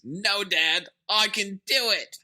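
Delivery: imitating a squeaky, pleading kid. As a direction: squeak it, drawing out the end. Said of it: "He squeaks".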